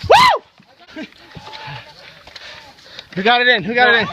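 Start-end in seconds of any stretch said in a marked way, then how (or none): 0.1-1.0 excited whoop. Real, not acted. Cheering at game end.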